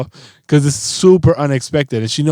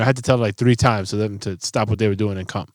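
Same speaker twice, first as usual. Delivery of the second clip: normal talking voice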